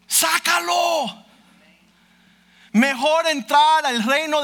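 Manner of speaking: loud, high, urgent preaching; impassioned sermon delivery; fiery preaching. Acted. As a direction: preach it as a warning from the pulpit. Preaching at full force.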